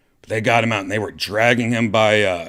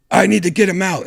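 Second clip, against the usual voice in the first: loud, much higher insistence; urgent demand; 12 semitones higher, 7 dB louder, faster than usual. Retold or imitated. Doctor insisting the patient be moved, loud urgent demand. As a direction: press hard, the patient must move.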